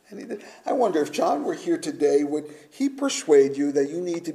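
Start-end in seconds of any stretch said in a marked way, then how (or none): 0.1-0.4 softer echo. Shown as a demonstration.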